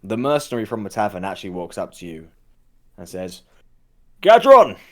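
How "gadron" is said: loud, high hail; calling out. Acted. Mercenary from the tavern hailing Gadron, loud call. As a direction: shout the name to catch his attention.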